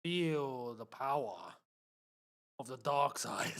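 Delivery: low, slow sinister villain line; lightly voiced movie villain. Quotes villain line mid-match.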